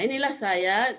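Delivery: usual speaking voice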